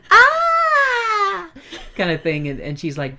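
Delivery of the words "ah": high, loud movie scream; mock scream. Starlet recalls her film role, mock high shriek. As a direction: shriek like a bombing-scene starlet.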